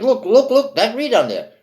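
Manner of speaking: excited Scrooge. Acted. Scrooge sees his young self.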